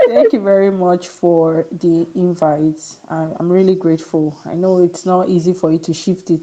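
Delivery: ordinary delivery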